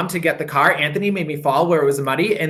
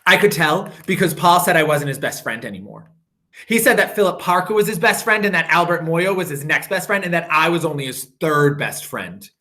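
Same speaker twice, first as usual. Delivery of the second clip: usual speaking voice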